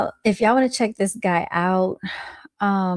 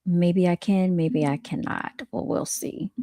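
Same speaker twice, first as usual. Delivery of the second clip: usual speaking voice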